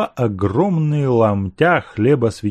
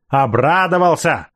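a savage mock shout, higher; fierce shout; 5 semitones higher than usual. A narrator's reading. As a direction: bark it out with fury. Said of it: "зверски закричала она опять".

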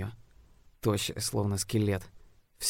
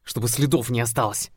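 louder, tense menacing hiss; hissed threat; 4 semitones higher, 6 dB louder than usual. A narrator's reading. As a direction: spit out the threat at the cheerleader. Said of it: "прошипел он".

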